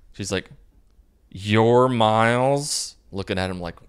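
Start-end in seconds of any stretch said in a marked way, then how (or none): 1.3-3.0 slow, louder, drawn-out scornful question; disdainful mom. Retold or imitated. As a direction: look him up and down with your voice. Disdainful mother meets boyfriend.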